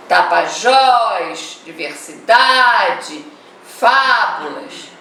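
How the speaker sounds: TapaJÓS, diversiDAde, FÁbulas, each tonic syllable stretched long; slow word by word, stretching JÓS, DA and FÁ; stressed syllable drawn out. Shown as a demonstration. Teacher reading titles to show their stressed syllables, tonic syllables drawn out. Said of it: "sílaba pronunciada com mais força".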